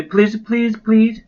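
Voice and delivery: high, eager begging; pleading cry. Acted. Toby begging his parents to name the rabbit, high pleading cry. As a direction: beg like a kid who wants it badly. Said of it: "cried Toby".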